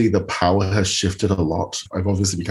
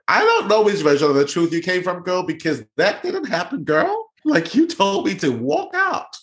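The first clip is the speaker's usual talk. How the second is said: put-on much higher, sassy girl-talk voice; sassy comeback; 10 semitones higher than usual. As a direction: clap back at her with attitude. Imagined sassy comeback to her.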